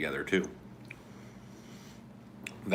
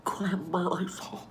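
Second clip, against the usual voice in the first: drooling voice; 12 semitones higher, slower than usual. Shown as a demonstration. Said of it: "kind of drooly sounding voice".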